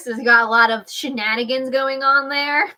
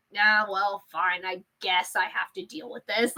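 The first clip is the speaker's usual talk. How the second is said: resigned, softer grudging acceptance; fed up; 6 dB quieter than usual. Retold or imitated. Fan imitating fed-up Persephone giving in, fed up. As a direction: give in wearily, as if imitating her. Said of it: "fed up".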